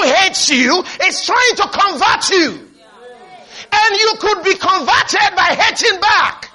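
emphatic preacher addressing a congregation; impassioned preaching. Real, not acted. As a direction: preach with force, stretching the big words. Preaches at full force.

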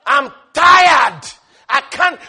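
fed-up complaint rising to a loud cry on tired; mock exasperated prayer. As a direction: whine to God, then burst out. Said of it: "He's not busy saying".

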